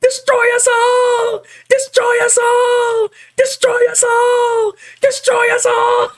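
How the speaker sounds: high, slow screaming villain imitation; cartoon villain scream. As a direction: scream it like a cartoon doom prophet. Repeating cartoon villain line.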